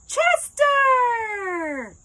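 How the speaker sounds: high, loud excited call; calling the dog. Acted. Woman greeting her ex's dog with a loud call.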